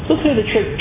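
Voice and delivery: usual speaking voice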